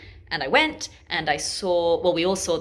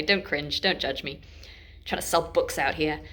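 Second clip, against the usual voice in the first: usual speaking voice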